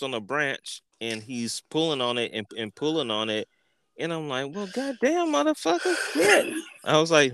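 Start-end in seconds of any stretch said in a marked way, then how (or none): 4.5-6.5 amazed squeal. Retold or imitated. High amazed swearing watching his friend pull the branch. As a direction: let the swearing climb higher.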